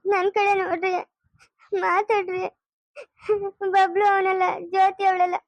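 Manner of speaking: desperate, weeping plea, rising toward the end; tearful pleading. Acted. Wife begging silent husband to sign, crying.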